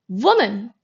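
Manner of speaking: ordinary delivery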